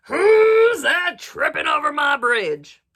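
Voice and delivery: slow, very loud bellow; troll's roar. A narrator's reading. Troll challenging the second goat, slow roar. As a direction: roar it out, stretching the words. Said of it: "roared the troll".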